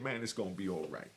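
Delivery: low, soft, gentle comfort; hushed, like consoling a broken man; soft reassurance. Retold or imitated. Cellmate hugging him in the cell, low soft reassurance.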